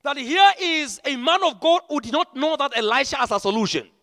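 loud, driving preacher's cry; full-force preaching. Real, not acted. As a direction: preach it out to the whole hall. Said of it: "Oh, yes, I'm preaching".

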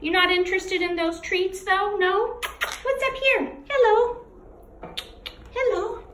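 very high cooing voice. Real, not acted.

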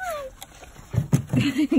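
delighted high-pitched laugh. Real, not acted. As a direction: laugh with joy as the pup makes it. Laughs after puppy slides.

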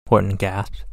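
ordinary delivery

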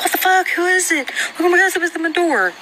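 high-pitched alarmed recounting; startled reenactment. Retold or imitated. Reenacting startled reaction to banging.

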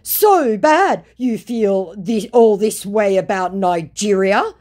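high, pleading mock voice of the scammer. Reading the scammer's message aloud in a high mock voice.

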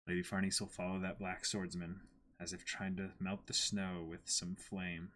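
soft, fading closing narration; reading aloud. Acted. Reading the volume's final page to co-host, hushed narration.

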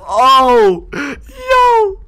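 high squealing disbelief; surprised. Real, not acted.

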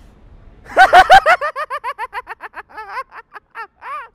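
high, loud cackling hyena laugh. Pretend. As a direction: cackle wildly like a giggling hyena. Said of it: "Can you give me your best hyena laugh?".

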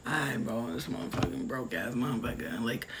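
low, soft disgruntled muttering; grudging aside. Acted. Listener grudgingly giving money to a broke friend, low muttered grumble. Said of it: "so you're like".

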